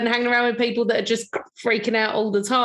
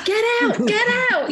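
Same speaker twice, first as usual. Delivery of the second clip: screaming at the screen; 13 semitones higher than usual. Retold or imitated. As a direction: yell a warning at the screen. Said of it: "You're screaming at the screen".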